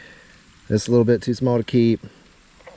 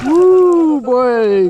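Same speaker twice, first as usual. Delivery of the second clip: high, loud whoop of joy; excited whoop; 12 semitones higher, 6 dB louder than usual. Real, not acted. Angler cheering as the fish swims off, high excited whoop. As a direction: let out a big happy woo.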